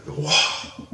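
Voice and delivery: airy, unvoiced mock wow; awestruck. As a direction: imitate a friend floored by amazement. Retold friend's amazed reaction.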